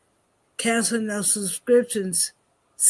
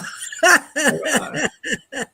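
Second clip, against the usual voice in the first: laughing; 5 semitones higher than usual. Real, not acted. Laughs at the accent joke.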